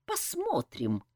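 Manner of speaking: high, soft, spiteful reply; spiteful challenge. A narrator's reading. Saeta defies the colonel's warning, quietly spiteful. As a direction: answer with narrowed-eyed spite in the voice. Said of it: "зло прищурилась Саэта".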